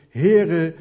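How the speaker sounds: a loud cry of Heere. Acted. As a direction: call out desperately for rescue. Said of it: "om uit te schreeuwen". (luide noodkreet)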